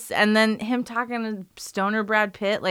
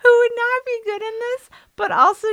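silly voice; 8 dB louder than usual. Pretend. Mock voice while joking.

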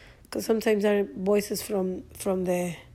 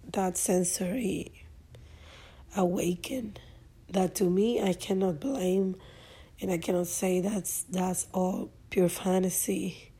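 usual speaking voice

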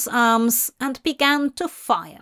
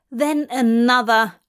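plain reading voice

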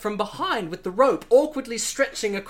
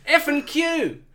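higher Aussie reply; Australian accent; 7 semitones higher than usual. Acted. Steve-O answering Bond in Australian accent. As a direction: answer like a proud local. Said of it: "replies Steve-O".

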